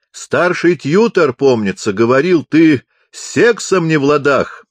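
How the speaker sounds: high, loud deaf man's shout. A narrator's reading. Chaplain recalls the senior tutor's report, shouting. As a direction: shout out a private matter openly. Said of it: "закричал капеллан".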